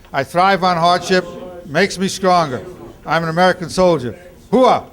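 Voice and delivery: loud rhythmic drill chant; loud gung-ho soldier. Shown as a demonstration. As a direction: chant it hard during push-ups. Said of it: "start saying it with me".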